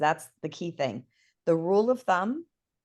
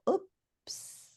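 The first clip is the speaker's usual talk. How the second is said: mock oops; 13 semitones higher, 7 dB quieter than usual. Minters realize mistake.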